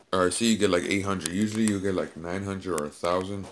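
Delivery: ordinary delivery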